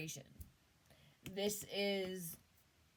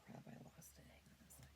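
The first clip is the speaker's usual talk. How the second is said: soft whispered aside off mic; 17 dB quieter, much faster than usual. Real, not acted. Reader losing her train of thought, whispering to the audience. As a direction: mutter it under your breath after losing your place. Loses her place mid reading.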